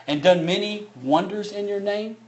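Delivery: preaching at full force. Acted. Preacher booming the words of Jesus to the church.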